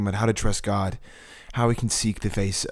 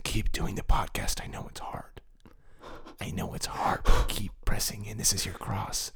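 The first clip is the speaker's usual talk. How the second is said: whisper; 7 dB quieter than usual. Retold or imitated. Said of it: "you saying".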